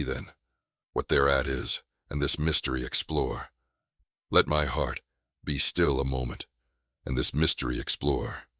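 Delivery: ordinary delivery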